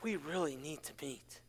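very low, hushed and grave; ominous second reading. Shown as a demonstration. Pastor showing how a text could sound angry, low and hushed. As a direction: drop it far down to a near murmur. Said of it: "Or you could read it as".

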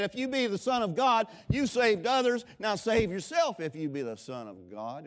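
mock him with sarcasm; sarcastic mockery. Crowd mocking Jesus on the cross, loud sarcastic taunt. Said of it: "in a sarcastic tone".

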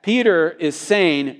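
normal talking voice

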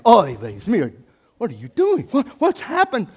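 high, loud Yiddish father; Yiddish accent. Retold or imitated.

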